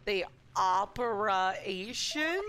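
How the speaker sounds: slow, drawn-out mock-dramatic question; dramatic echo. Streamer teasing the game's line, slow mock-drama. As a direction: stretch "operation" out like a dramatic reveal.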